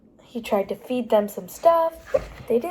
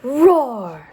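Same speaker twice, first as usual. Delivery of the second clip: grumpy roar; 6 dB louder than usual. Acted. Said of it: "became extra grumpy with them".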